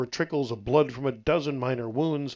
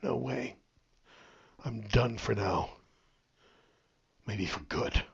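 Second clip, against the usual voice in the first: low, quiet refusal; firm and weary; 3 semitones lower, 7 dB quieter, slower than usual. A narrator's reading. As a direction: shut it down, drained and final. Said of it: "shook his head emphatically".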